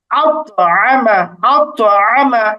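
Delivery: "আত আমা" very slowly, with pushed t; bouncing qalqalah. Shown as a demonstration. Teacher models it for learners, very slow qalqalah. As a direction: bounce the heavy t in "আত আমা", drawing it out. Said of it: "এরকম উচ্চারণ হবে".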